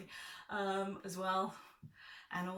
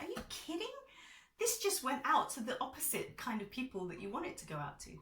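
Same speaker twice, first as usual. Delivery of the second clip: higher, incredulous; inner pep talk; 7 semitones higher, 3 dB louder than usual. Retold or imitated. Answering her own doubts, incredulous and defiant. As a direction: scoff at your own doubts. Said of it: "another part of me was like".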